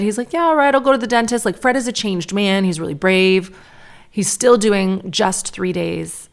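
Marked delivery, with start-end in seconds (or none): 0.3-1.4 put-on high, quick, eager little boy; excited child. Son agreeing to the dentist at first, excited. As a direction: imitate an eager kid in a high, fast voice. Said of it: "he's really excited".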